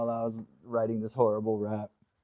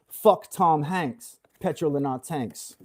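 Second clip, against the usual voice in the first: very slow mock British accent, laughing at the end; hammed-up British accent; 3 semitones higher, slower than usual. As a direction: stretch it out in a put-on British accent. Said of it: "does a better British accent".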